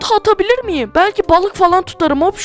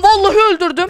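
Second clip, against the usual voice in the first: ordinary talk, nothing marked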